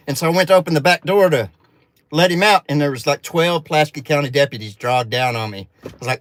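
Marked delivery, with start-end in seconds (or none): none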